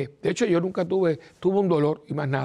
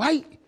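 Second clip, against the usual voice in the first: retold cry of pain; 12 semitones higher than usual. Sudden stab of pain retold.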